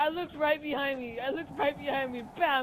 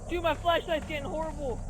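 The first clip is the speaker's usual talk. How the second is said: usual speaking voice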